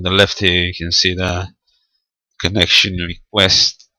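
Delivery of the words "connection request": ordinary delivery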